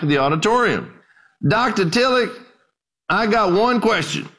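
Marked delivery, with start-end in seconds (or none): 1.5-4.3 put-on old preacher. Old preacher voiced in story.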